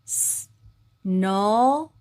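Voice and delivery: "스" whispered then "노"; "스, 노" ayrı sesler; split s-no. Shown as a demonstration. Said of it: "오 붙이면".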